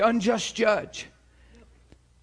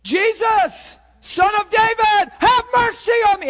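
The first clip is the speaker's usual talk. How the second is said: higher and louder, building to a shout; desperate cry; 9 semitones higher, 6 dB louder than usual. Acted. Blind man crying out to Jesus, building shout. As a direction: cry out over the crowd. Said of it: "he's walking and".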